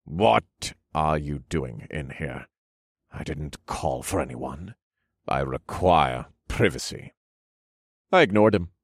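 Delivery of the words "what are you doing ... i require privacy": low, slow, cold nobleman; stern demand. A narrator's reading. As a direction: glare and demand privacy. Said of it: "whirled and glared at me".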